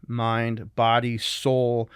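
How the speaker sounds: ordinary delivery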